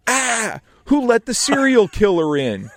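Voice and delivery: high, loud mock yell; mock scream. Agency producer mocking Sam with a high, loud mock yell. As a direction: shout it across the room. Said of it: "he screams out".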